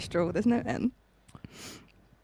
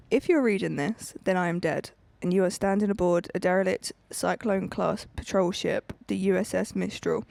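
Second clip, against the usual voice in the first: story reading; 7 semitones lower than usual. Acted. Reading a sci-fi story opening to listeners on the podcast, measured narration. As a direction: read it like a dead man's log.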